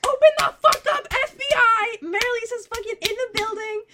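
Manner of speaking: high, full-out yell through a door; hammed-up urgent shouting; police-raid shout. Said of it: "So I'm banging on the door".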